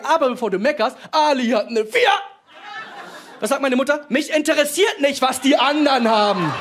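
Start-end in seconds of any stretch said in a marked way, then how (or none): 4.1-6.5 a lightly voiced strict mother, higher and slow; stern mother. Mother brushing off her son's excuse, stern and slow. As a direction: lay down the law, slowly.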